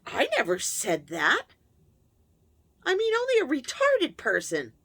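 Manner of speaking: a sharp, loud mother's retort rising high; angry denial. Retold or imitated. A mother denying her words to her daughter, sharp and loud. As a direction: snap back and belittle. Mother denies and insults child.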